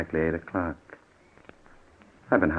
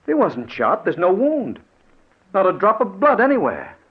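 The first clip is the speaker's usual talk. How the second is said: higher, louder insisting; agitated protest; 13 semitones higher, 7 dB louder than usual. Acted. Charlie insists to Gibson Norton isn't hurt, agitated. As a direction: protest urgently.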